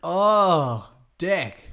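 loud, high drawn-out "Oh" of understanding; hammed-up penny-drop moment; sudden realisation. Finally understanding a mate in a sponsor skit, exaggerated realisation.

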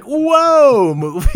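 high, loud drawn-out "whoa"; mock-alarmed parent pulling the movie up short; taken aback. Acted parent reacting at the movie.

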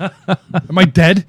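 drawn-out, high comic bewilderment; mock panic. Teasing friend who passed out on the couch, mock panicked. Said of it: "Wake up in a panic".

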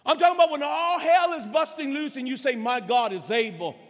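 loud, driving preacher's delivery; forceful preaching. Real, not acted. Preacher urging the congregation to trust, forceful preaching. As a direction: preach it hard and urgently.

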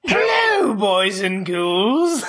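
camp it up like a horror host; hammy spooky greeting. Acted. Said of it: "be hammy assholes".

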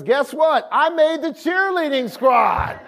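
high, excited announcement; excited news. Retold or imitated. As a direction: burst out with the good news. Daughter announcing she made squad.

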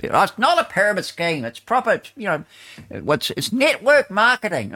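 mock Amway seller. Mocking Amway recruiter insisting it's not a pyramid scheme, high put-on voice.

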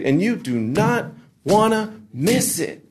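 high, slow, drawn-out mock hype; emcee hype. Emcee teasing next week's series, exaggerated slow hype. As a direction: stretch each word like a promo host. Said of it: "It's like we talk different".

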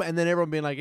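usual speaking voice

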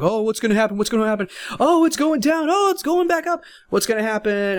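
high, anxious mock worrying; mock fretting over every tick; panicky chart watcher. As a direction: ham up a nervous trader glued to the chart. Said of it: "I was like".

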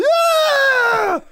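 loud, high drawn-out "aah" scream; retold scream. Acting out his friend's slide toward the hole, screaming. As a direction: scream out as a friend sliding helplessly. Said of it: "He was just going".

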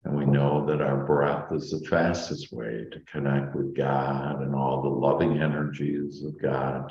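normal talking voice

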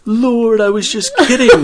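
high, loud comic wail; mock pleading outcry. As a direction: cry out to heaven in mock panic. Retold panicked reaction, played for laughs.

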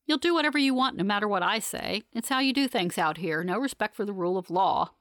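ordinary delivery

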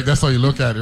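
normal talking voice

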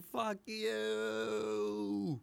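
high defiant shout to the heavens; Woody Harrelson scream. Retold or imitated. Movie character on a mountaintop broadcasting to the world, screaming defiance. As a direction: yell it at the sky as the world ends. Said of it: "broadcasting to the world on the top of a mountain screaming".

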